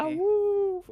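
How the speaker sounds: high, loud woo; excited whoop. Real, not acted. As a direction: whoop with delight. Excited at wolves.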